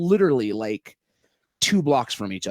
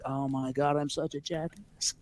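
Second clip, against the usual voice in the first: mortified; 8 dB quieter, slower than usual. Retold or imitated. Retells embarrassed self-reproach.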